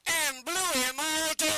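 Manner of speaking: slow, drawn-out ringmaster call; booming barker call. Shown as a demonstration. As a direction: belt it slowly to the crowd.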